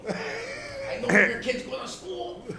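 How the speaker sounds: slow, high, laughing fake menace; mock mafia threat. Comic threatening the pizza shop, playful slow menace. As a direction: threaten them as a joke gangster.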